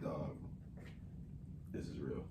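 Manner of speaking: low, hushed aside. Retold or imitated. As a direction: mutter it under your breath.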